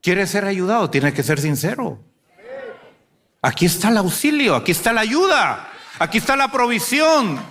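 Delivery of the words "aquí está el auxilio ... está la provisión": rising, high-pitched exhortation; full-force preaching. Real, not acted. Preacher offering help to the congregation, impassioned exhortation. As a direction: proclaim it to the congregation, pitch climbing with each phrase.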